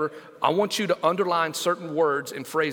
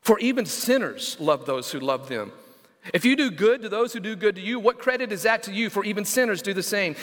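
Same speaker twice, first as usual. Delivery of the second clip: scripture reading; 5 semitones higher than usual. Acted.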